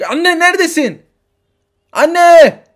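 high, loud cry for mom; calling out. Acted. Child calling for his mother, loud cry. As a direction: shout across the building.

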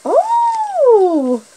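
high surprised oh. Acted. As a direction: sound amazed for the kids. Surprise at the bag.

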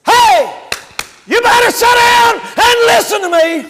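high, loud shouted exhortation; full-force preaching. Real, not acted. Preacher demanding the congregation listen tonight, shouting.

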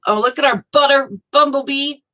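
ordinary talk

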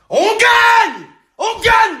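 hyped-up shout. Acted. Gambler rallying his friends to win, hyped-up shout.

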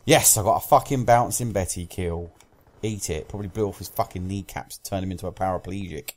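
ordinary delivery

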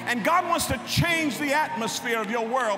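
high, loud preaching cry; full-force preacher; preaching shout. Acted. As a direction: lift the church with a preached shout.

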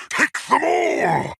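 high, urgent war cry; shouted attack order. Acted. Warlord sending fighters to take all, high shouted command.

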